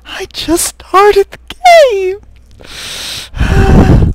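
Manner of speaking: shrill-high wail of panic, loud; panicked pleading. Real, not acted. Player to the game's attacker, panicked screaming plea. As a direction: beg in a loud, very high scream, dragging the words.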